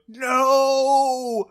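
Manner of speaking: high, loud drawn-out mock cry; panicked no. Deputy behind the gunman, panicked drawn-out cry. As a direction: wail it in hammed-up dread. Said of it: "Barney is behind him".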